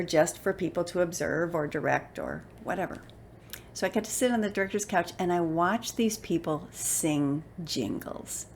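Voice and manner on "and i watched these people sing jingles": normal talking voice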